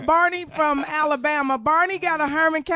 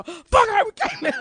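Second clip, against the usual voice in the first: "Fuck Herman Cain" as a slow, higher ringtone announcement; 9 semitones higher, slower than usual. Pretend. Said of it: "It's just gonna go".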